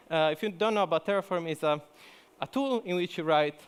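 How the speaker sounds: ordinary talk, nothing marked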